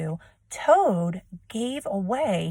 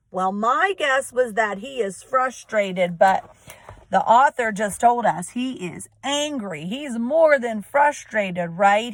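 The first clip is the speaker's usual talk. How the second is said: ordinary talk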